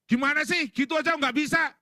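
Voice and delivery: high, loud exasperated outburst; acted irritated yelling; snapping scolding. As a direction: snap impatiently at a useless helper. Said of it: "Jangan bentak".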